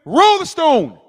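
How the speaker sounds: loud, high mad shout; angry command. Acted. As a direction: fire off the order as an angry Christ. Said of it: "He's still angry inside".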